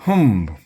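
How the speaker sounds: loud, high huff; sharp, affronted humph; indignant humph. A narrator's reading. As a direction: huff in offence. Offended lady's huff before leaving.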